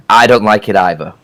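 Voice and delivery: normal talking voice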